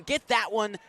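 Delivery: ordinary talk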